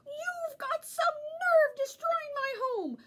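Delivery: angry little leprechaun yelling. A narrator's reading. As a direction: drive the intruder off with a roar. Angry leprechaun scolding dog.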